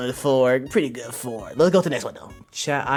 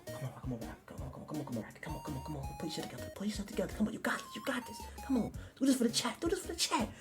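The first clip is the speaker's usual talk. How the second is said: very soft muttering, climbing higher; hushed self pep talk; 5 semitones higher, 16 dB quieter, much faster than usual. Pretend. Streamer urging himself on for the chat, hushed and frantic. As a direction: mock-desperate pleading under the breath.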